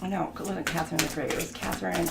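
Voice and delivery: ordinary delivery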